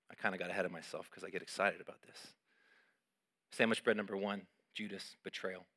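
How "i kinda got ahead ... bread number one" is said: ordinary talk, nothing marked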